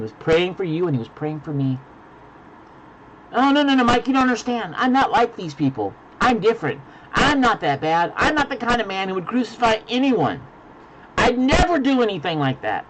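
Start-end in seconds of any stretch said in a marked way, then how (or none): 3.3-12.8 high, pleading protest of an offended churchgoer; defensive objector. Acted. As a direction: protest in a higher voice, insisting you're different. Listener objecting to the preacher.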